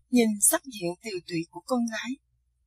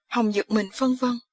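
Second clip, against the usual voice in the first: plain reading voice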